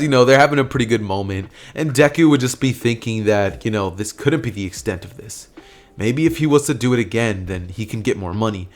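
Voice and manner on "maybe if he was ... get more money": ordinary delivery, nothing marked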